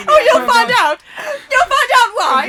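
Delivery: loud, high laughing threat; menacing villain. Acted. Host with a hammer taunts the players, high and loud. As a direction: taunt them like a mad villain.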